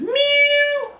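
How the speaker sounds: very high squeaky meow; cat meow. Acted. Kitty answers her owner's scolding with a high squeaky meow. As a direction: mew like a small indifferent cat. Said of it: "Kitty said".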